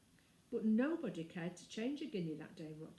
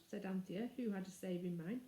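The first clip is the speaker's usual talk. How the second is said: plain reading voice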